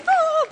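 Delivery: a high mock squeal, at normal volume; imitated squeal. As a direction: play the excited contestants squealing backstage. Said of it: "these ladies were screaming and going".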